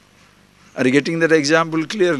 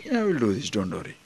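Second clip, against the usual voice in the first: cool relaxed; 5 semitones lower, 10 dB quieter, faster than usual. Acted.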